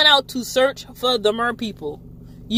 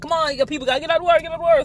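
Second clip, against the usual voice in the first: fast, urgent fatherly order; hurried dad; 5 semitones higher, much faster than usual. Retold or imitated. Dad ordering swimmers out of the water, hurried. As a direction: rush them out like a worried dad. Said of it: "So Dad's like".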